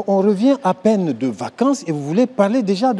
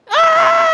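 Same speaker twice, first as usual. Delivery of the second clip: mock scream; 7 dB louder than usual. Mimics passenger scream mid announcement.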